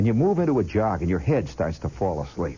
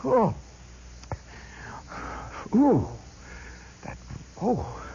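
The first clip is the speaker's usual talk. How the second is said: gasp of surprise; 14 semitones higher, 4 dB quieter, slower than usual. Retold or imitated. Reaction to the burning bourbon.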